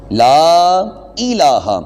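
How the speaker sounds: لا الہ with the لام of لا drawn out long; slow Kalima recitation. Shown as a demonstration. Teacher recites لا الہ slowly to students. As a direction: stretch لا الہ slowly, lengthening each لام. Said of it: "لام کو بھی لمبا کریں".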